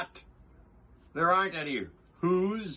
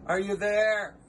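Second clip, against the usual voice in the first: higher, louder call into the clover; 7 semitones higher, 4 dB louder than usual. Acted. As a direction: call out hopefully to tiny friends. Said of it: "and called".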